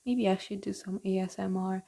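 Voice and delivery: ordinary talk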